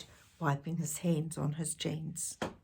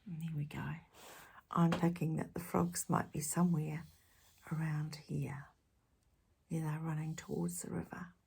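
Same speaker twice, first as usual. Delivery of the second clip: soft whispered asides; 2 semitones lower, 8 dB quieter than usual. Shown as a demonstration. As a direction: whisper as if not to scare frogs. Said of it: "whispered asides to the child".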